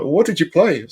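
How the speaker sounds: high, lightly imitated kid voice; child's question. Child asking dad about his old games, lightly mimicked high voice. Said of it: "was like".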